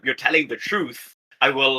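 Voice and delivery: ordinary delivery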